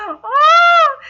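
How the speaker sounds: loud high "oh"; drawn-out swooning squeal; swooning cry. Real, not acted. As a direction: melt loudly at the romance. Moved by a romantic line.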